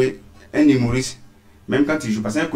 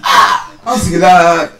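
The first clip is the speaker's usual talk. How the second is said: shouted order; 6 semitones higher, 6 dB louder, slower than usual. Acted. Comic sketch, customer in restaurant.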